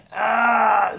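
high, loud acted scream; sudden cartoon scream. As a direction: burst out with a short shrieking yell.

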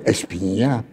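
soft, puzzled rising "Espinha?"; a light imitation of a puzzled waiter; waiter mishearing. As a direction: mimic the confused waiter quietly. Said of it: "E ele diz".

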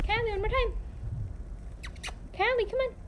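high-pitched encouraging pet talk; coaxing a pet. Real, not acted. Owner asks Callie for the trick, high coaxing voice. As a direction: coax the animal along in a high voice.

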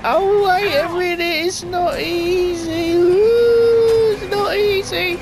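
high, straining panicky whine; losing it at the wheel, voice climbing high; frantic strain. Real, not acted. Gamer straining at the wheel during a chase, high and panicky.

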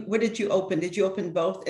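usual speaking voice

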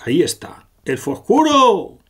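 high, loud call naming the enemy; shouted discovery. Acted. Sakura calls out to her friends that the dark elf is there. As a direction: yell it out as you spot him.

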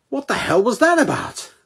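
high fast indignant snail. Acted. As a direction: squeak it out fast and offended. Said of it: "The snail says".

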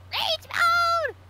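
high, loud mock battle cry; mock rage. Imitating Blissey called cute, mock rage. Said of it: "Whenever she gets titled cute".

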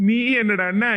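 ordinary talk, nothing marked

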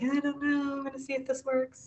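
quiet, hesitant and noncommittal; unsure mumble. Retold or imitated. Author recalling her wishy-washy first try, quiet and unsure. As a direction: shrug it off softly, unsure it'll work. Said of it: "I was just like".

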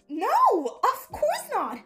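high, drawn-out protest with a laugh; amused denial. Acted. Friend denies dating best bud, high laughing protest.

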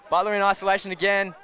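ordinary talk

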